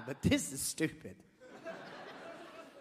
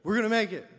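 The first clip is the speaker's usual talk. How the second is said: loud nervous pep talk; 5 semitones higher, 7 dB louder, faster than usual. Retold or imitated. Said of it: "I was scared, man".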